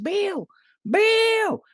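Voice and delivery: very high, calling across a field; high hollering call of Bill. Pretend. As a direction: yell his name from far away.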